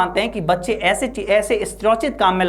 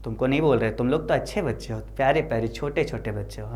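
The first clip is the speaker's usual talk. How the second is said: gentle reassurance; 6 semitones lower, 6 dB quieter than usual. Real, not acted. Reassures students after acted scolding.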